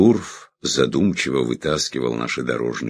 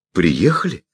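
surprised; 6 semitones higher, 4 dB louder than usual. Acted. Amazed delighted question.

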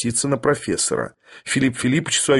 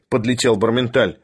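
ordinary delivery, nothing marked

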